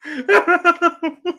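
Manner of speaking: high cackling laugh; short burst of high laughter; real laughing fit. Real, not acted. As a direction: crack up at a joke. Laughs at super chat.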